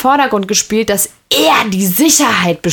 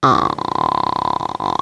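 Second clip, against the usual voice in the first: long low creaky ah; vocal fry; 4 dB quieter than usual. Shown as a demonstration. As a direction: drag out a creaky ah. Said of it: "da finde ich dieses".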